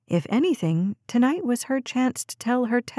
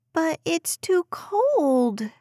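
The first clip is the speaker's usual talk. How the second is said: put-on high, slow, whiny little girl's protest; a preschooler complaining to her mom; complaining child; 8 semitones higher, much slower than usual. A narrator's reading. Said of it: "Des complained".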